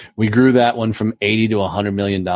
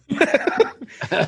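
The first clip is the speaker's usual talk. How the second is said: big laugh. Real, not acted. Laughs at his own story.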